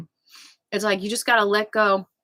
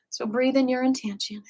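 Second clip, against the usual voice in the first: guided meditation; 3 dB quieter than usual. Acted. Leads a breathing meditation.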